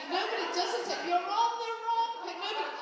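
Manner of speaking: high, loud bickering back and forth; two pensioners arguing over the page; squabbling old students. Retold or imitated.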